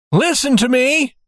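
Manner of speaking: high-pitched urgent call; loud calling. A narrator's reading. Banker calling to the trapped child, urgent shout. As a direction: project it to someone shut inside. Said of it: "he called as loudly as he could".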